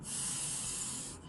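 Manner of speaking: unvoiced hiss, "S" as a long sssss; hissing S sound. Shown as a demonstration. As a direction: hiss the "S" like a snake. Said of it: "S makes a sound like a snake".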